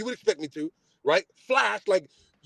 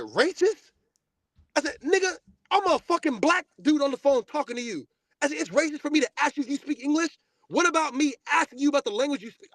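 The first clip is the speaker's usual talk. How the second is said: higher, furious retold yell; raised furious retelling; angry cussing; 7 semitones higher than usual. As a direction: chew him out over the phone. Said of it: "I start cussing".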